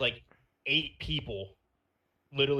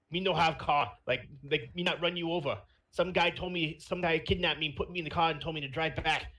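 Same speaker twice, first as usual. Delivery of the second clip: higher-pitched mock Chinese accent; 5 semitones higher than usual. Retelling his role play to cops, mock Chinese accent. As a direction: plead in a put-on Chinese accent. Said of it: "I adopted a Chinese accent".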